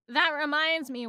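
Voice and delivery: usual speaking voice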